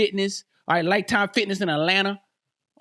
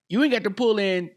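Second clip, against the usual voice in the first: ordinary talk, nothing marked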